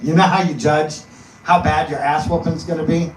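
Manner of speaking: ordinary talk, nothing marked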